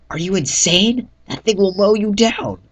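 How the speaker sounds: high, loud, alarmed protest; frightened shout. Acted. Lone protests narrator's rescue plan, scared shout. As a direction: yell at him to stop out of fear. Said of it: "said Lone, scared for my safety".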